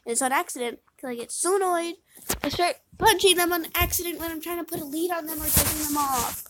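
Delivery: normal talking voice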